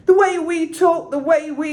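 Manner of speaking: high and loud, overpushed; high loud overpushed croon; pushed too hard. Shown as a demonstration. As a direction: belt it too much, the wrong way. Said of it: "it's not like".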